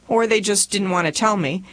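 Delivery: usual speaking voice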